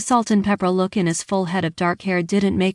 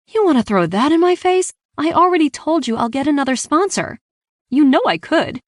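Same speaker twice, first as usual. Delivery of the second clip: much higher, louder angry challenge; indignant retort; 9 semitones higher, 4 dB louder than usual. A narrator's reading. Daughter throwing dad's sponsorship back at him, indignant retort. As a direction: fire back in heated defiance.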